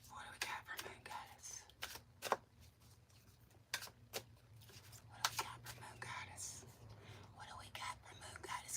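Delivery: breathy unvoiced whisper. Shown as a demonstration. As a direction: whisper it right into the mic. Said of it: "Can y'all hear me talking?".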